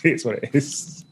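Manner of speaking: ordinary talk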